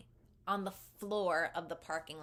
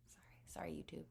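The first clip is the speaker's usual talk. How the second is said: whisper; 2 semitones lower, 12 dB quieter, faster than usual. Real, not acted. Apologizing to viewers after swearing.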